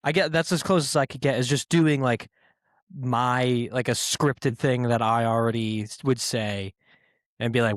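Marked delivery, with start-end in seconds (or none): none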